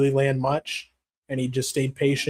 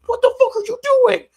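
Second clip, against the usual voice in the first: high, loud enraged shriek; imitated outraged yelling, ending in a scream; mock furious; 8 dB louder, slower than usual. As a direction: scream it like a fighter losing it. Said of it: "just all fucking mad".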